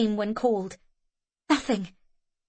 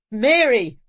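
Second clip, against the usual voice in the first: loud desperate shout; desperate calling; 3 semitones higher, 8 dB louder than usual. A narrator's reading. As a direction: call her name desperately. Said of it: "calling too, desperately".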